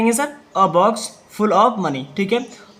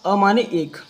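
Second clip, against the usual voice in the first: normal talking voice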